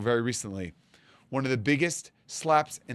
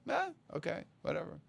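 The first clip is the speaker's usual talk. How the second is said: indifferent; 4 semitones higher, 10 dB quieter than usual. Retold or imitated. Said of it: "You're like".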